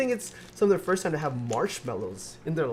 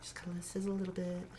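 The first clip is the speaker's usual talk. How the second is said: [name] impression; 3 semitones higher, 10 dB quieter, much faster than usual. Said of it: "I don't know if he sounded exactly like that".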